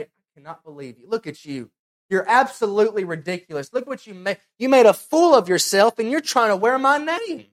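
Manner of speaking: starts quick and hushed, then builds to a loud, high rebuke; a judge swelling from stunned murmur to a loud scolding; God's stern rebuke. Pretend. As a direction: disbelief growing into outrage at the fool. God rebuking at judgment.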